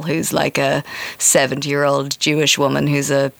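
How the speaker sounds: normal talking voice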